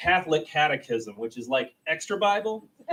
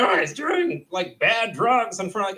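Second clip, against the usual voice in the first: freaked-out parent; 7 semitones higher than usual. Retold or imitated. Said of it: "be like".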